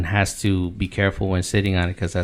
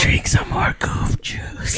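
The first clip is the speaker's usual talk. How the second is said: mock whisper; 4 dB quieter, slower than usual. Joking whispered advice.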